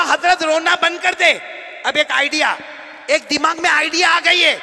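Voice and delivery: high, loud eager call; eager student. Retold or imitated. Student eagerly calling out to his weeping teacher. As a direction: burst in with an idea.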